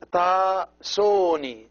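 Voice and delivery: "soni" with a long, drawn-out O. Shown as a demonstration. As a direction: stretch the O in "soni" slowly. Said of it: "long O".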